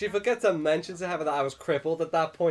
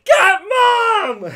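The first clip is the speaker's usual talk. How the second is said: high, loud screamed call for help; retold panicked scream; 16 dB louder than usual. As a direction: scream it like a hurt kid. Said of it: "And then I screamed".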